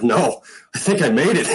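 high-pitched, breaking into a laugh; retold happy disbelief. Player telling his dad he made it, excited. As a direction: share good news you barely believe.

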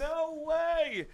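very high, stretched squeal of disbelief; thrilled disbelief. Real, not acted. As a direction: squeal it, amazed by the gift. Reacting to surprise record gift.

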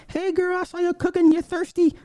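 high squealing fan voice praising her; gushing fan. Retold or imitated. Couple cheering Hillary's thirsty cooking, in a high squeal.

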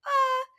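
high, loud frightened squeal; scared squeal. Retold or imitated. Scared reaction to Minnie.

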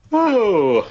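excited cheer. Real, not acted. Cheering a wrestler's name.

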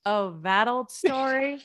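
loud, drawn-out mock scoff; mock dismissive. Husband teasing his crying wife, mock dismissive. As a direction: imitate a husband brushing it off, loudly.

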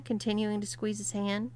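plain reading voice

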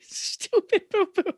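put-on childish taunt. Echoing childish insult.

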